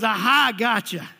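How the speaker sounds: loud gloating aha; spring the trap, gloating; gloating devil. Retold or imitated. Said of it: "the devil goes".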